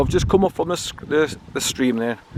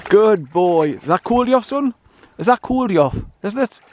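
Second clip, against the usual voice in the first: cooing at the dog; 7 semitones higher than usual. Real, not acted. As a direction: coo at him like a pet.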